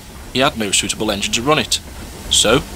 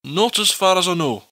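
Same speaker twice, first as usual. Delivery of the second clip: put-on broad Scottish accent; 7 semitones higher than usual. Douglas answers in his voice.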